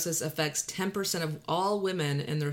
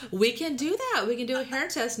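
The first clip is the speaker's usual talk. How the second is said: laughing; 10 semitones higher than usual. Real, not acted. Laughing at mom joke.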